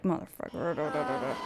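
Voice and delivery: low, soft, slow mock mutter; muttered curse. Queen enduring their flirting, low muttered curse. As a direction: curse under your breath, drawn out. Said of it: "the queen's just enduring".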